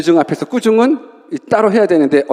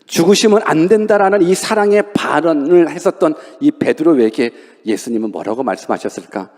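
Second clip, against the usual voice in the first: normal talking voice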